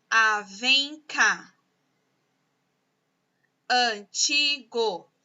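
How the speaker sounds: each word drawn out slowly for dictation; teacher dictating words; slow word list. Shown as a demonstration. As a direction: read the list at a crawl. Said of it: "das próximas palavras".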